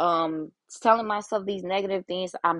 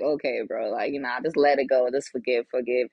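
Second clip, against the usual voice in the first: low, easygoing just-let-it-go voice; mock laid-back advice; 8 semitones lower than usual. Someone telling a friend to just forgive, low and laid-back. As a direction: go deep and easy, like shrugging it all off. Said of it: "when you tell them".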